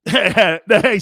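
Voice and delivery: normal talking voice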